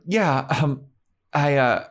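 lightly voiced nervous Buffy; mock hesitant Buffy. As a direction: stumble through the lie like a bad liar. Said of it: "Buffy's like".

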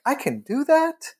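high rising disbelief; sudden realization, voice leaping up at the end; amazed realization. Retold or imitated. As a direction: say it as a dawning, high-pitched question. Acted sudden realization on stopping.

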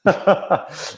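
breathy burst of laughter. Real, not acted. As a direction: laugh out loud at the joke. Laughs at own joke.

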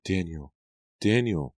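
distant, plaintive name-calling; Nora. A narrator's reading. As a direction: call out faintly, then lift it. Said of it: "calling his name from far away".

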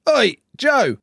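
loud, high imitation of a fan's yell; calling out. Fan shouting to Joe in the street, imitated loud call. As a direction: holler it across the street.

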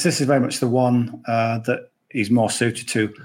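ordinary talk

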